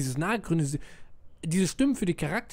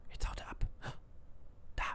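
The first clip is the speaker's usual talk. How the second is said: breathy hushed whisper; 13 dB quieter, faster than usual. Acted. Player voicing a sneaking soldier, whispering. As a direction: keep it to a whisper so the guards don't hear.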